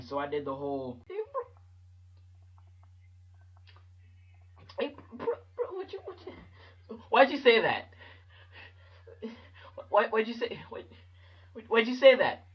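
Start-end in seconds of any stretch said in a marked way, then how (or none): 1.1-12.4 high, halting, choked-up protest; holding back tears. Retold or imitated. Third grader hiding tears from the kids who roasted him, choked up. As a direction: squeak it out between pre-cry breaths. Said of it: "can't let nobody know that you crying".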